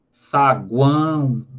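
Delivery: "Saguão" drawn out very slowly to show the strong vowel; slow example word. Shown as a demonstration. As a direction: stretch "Saguão" slowly, leaning on the "ão". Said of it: "O an é o mais forte". ("Saguão" bem devagar, alongando o "ão")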